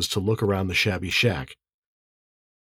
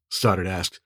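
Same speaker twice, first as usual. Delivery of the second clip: usual speaking voice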